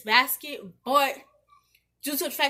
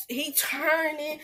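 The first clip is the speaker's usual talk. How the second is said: usual speaking voice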